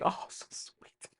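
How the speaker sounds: slow whispered "aww, so sweet"; mock sweet. Audience imagined gushing over the sweet aunt, whispered. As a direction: breathe it out like a doting listener. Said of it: "You might think".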